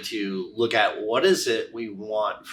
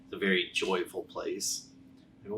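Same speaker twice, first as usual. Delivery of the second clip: plain reading voice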